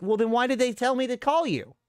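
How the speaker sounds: high-pitched incredulous question; retold exasperated comeback; exasperated protest. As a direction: push it up high, baffled and put out. Acted argument with phone support.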